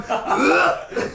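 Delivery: high laughing gag sound; mock retching bleh. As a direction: gag like the stink hit him. Plumber retching at the smell.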